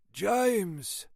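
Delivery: high, drawn-out mournful greeting. A narrator's reading. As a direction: say the name as a mournful sigh of a hello. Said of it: "he said mournfully".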